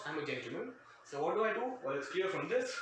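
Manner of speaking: ordinary talk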